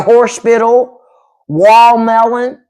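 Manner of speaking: "horse spittle" for hospital, "wall melon" for watermelon, slow; heavy-tongued Southern words. Shown as a demonstration. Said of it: "Wall melon requires you to".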